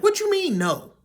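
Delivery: outraged what you mean. Retold or imitated. Narrator confronts the mom's no, in a high outraged cry. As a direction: fire it back in disbelief. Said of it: "I was like".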